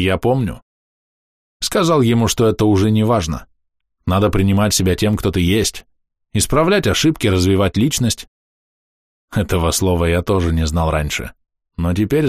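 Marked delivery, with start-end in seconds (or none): none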